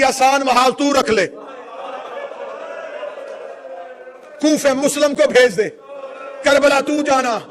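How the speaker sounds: high, loud, impassioned mourning oratory; full-force lament preaching. Real, not acted. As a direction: cry out the tragedy to a grieving crowd.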